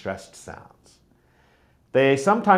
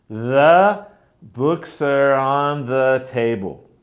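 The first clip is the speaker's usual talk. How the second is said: slow, small words melted into schwa; slow, reduced demo; slower than usual. Shown as a demonstration. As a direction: say it slowly, let the unstressed words merge. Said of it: "these words actually sort of merge".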